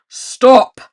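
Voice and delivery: huge loud giant roar. A narrator's reading. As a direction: stop the troops with one roar. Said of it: "he roared".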